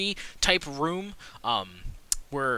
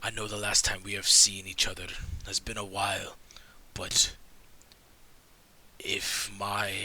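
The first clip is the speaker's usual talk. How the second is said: hushed conspirator; 6 semitones lower than usual. Acted. Game master voices a grim conspirator warning the party, in a low whisper. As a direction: lean in and share a dark secret.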